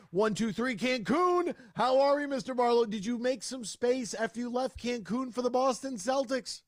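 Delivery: loud, high, pumped-up welcome; big radio host shout-out; excited intro. Real, not acted. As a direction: hype up the guest like an announcer. Host hypes guest's intro.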